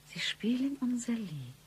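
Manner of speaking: breathy secret whisper. Acted. Wife whispers to Bruno about their song. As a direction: lean in and whisper it.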